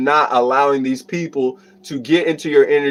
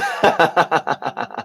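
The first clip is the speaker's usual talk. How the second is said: real amused laugh, trailing off; 3 semitones lower than usual. Real, not acted. Streamer laughing off a viewer's accent request, amused laugh.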